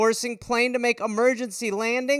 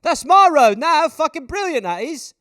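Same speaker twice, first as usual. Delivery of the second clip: mock thrilled thief; 5 semitones higher, 6 dB louder than usual. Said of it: "people are like".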